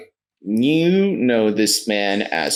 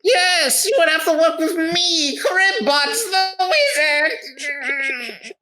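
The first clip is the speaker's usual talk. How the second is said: very high voice, name drawn out slowly; showy wizard merchant; slower than usual. Acted. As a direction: announce yourself as a theatrical high-pitched wizard. Wizard announces himself grandly.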